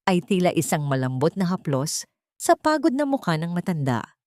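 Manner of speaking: usual speaking voice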